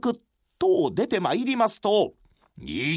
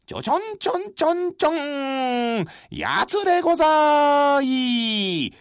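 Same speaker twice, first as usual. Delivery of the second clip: drum beat and call; 3 semitones higher than usual. Acted. Beating drum, calling hour.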